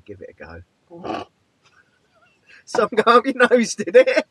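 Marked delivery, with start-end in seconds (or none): 2.6-4.2 high, loud tease bubbling with laughter; amused teasing. Real, not acted. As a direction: tease them loudly, on the edge of laughing. Teasing amid laughter after drink mishap.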